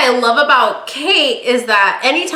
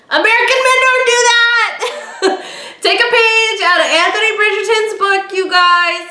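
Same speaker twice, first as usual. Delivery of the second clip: laughing exclaim; 10 semitones higher than usual. Real, not acted. Excited rant about American men.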